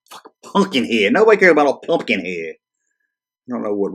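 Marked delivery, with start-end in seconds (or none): 0.1-1.1 whispered under the breath in contempt; disgusted whisper. Real, not acted. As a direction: hiss it as a scornful aside. Disgusted aside on list.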